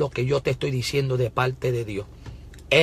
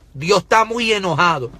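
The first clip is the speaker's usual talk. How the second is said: raised prophetic warning; preaching judgment on the nation; full-force preaching; 3 semitones higher, 4 dB louder than usual. Real, not acted. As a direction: press the warning home.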